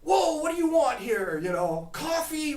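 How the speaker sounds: loud, high indignant shout; mocking outrage. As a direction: bellow it at the stranger, scandalised. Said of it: "the guy with the whiskey flipped out".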